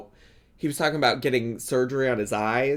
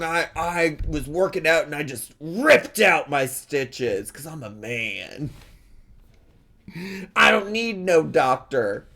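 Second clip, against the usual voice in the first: dumb tough-guy voice, mock boasting; mocking macho guy. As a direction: ham up a meathead bragging about being a man. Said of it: "He's like".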